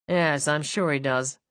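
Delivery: husband doubting the Viscount's motives, sarcastic; sardonic tone. Acted. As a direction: let the doubt drip from it. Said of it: "Ignoring his tone".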